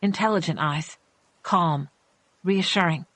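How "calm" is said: ordinary delivery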